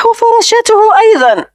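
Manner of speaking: put-on high-pitched delighted child voice; little girl. A narrator's reading. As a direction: pipe up like a little girl with a new toy. Child shows off her new toy. (صوت طفلة فرحة بنبرة عالية)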